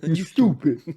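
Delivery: loud teasing jab; mock insult. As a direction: tease him like a dummy. Teasing co-host.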